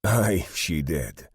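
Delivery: slow, drawn-out amused agreement; amused, laughing. A narrator's reading. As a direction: confirm it with a touch of amusement. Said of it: "says with a laugh".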